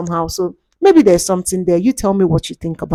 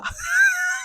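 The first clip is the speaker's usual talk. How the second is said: high shrieking witch laugh; witch cackle; 9 dB quieter than usual. Pretend. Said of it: "sound like a witch".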